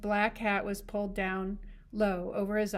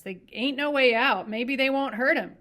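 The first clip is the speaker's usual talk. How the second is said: louder, sharp and cutting; 5 semitones higher, 10 dB louder than usual. A narrator's reading. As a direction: snap it out firmly. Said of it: "he said sharply".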